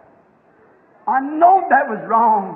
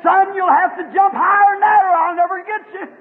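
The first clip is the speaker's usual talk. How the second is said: high, raised mother eagle's call; urgent calling; 6 semitones higher, 4 dB louder than usual. Acted. Mother eagle urging her son to jump, high urgent call. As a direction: call up the young one from afar, pitched high.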